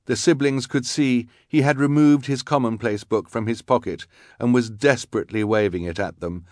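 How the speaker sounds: plain reading voice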